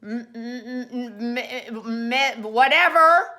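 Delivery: confused stammer. Retold or imitated. People can't read the signature, stammer then shrug. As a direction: stumble over it, then give up. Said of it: "And people are like".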